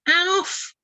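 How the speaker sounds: high eager call across the water. Acted. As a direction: hail a friend from above. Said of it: "he cried again".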